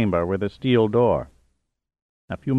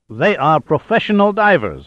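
high-pitched, indignant retort; official's heated comeback; 6 semitones higher, 3 dB louder than usual. A narrator's reading. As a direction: throw it back indignantly. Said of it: "Halder retorted".

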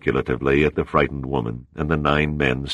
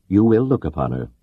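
cold; 4 semitones higher, 3 dB louder than usual. A narrator's reading. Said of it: "Prevlov said coldly".

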